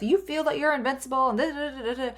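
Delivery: high, mild mock of a lecturing mother; mother's scolding. Quoting her mother's old line to the audience, a light high mocking voice. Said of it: "my mother told me that throughout my life".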